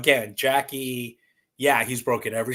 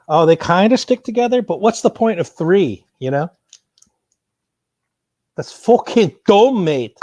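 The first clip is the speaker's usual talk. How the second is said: much higher and louder, fed up; exasperated outburst; 7 semitones higher, 13 dB louder than usual. Real, not acted. Vlogger annoyed at the toy's useless parts, loud exasperated rant. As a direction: rant at how pointless it is. Frustrated rant at toy design.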